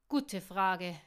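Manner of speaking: loud, cutting retort; snappish, forceful comeback; biting snarl. A narrator's reading. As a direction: spit it back angrily. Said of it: "fauchte er bissig".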